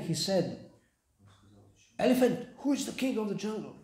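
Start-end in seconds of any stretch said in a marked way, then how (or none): 2.0-3.8 getting angry. Acted. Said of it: "he started to become angry".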